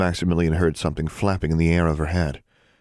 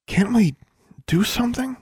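put-on pleading girl. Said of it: "she asked".

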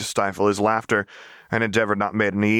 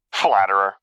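amused, louder brush-off with a laugh in it; laughing dismissal; 5 dB louder than usual. Acted. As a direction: laugh the compliment away. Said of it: "dismissed with laughter in his voice".

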